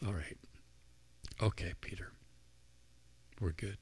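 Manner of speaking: soft whispered reassurance; gentle whisper. Acted. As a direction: breathe it gently, like forgiving a friend. Jesus gently forgives Peter.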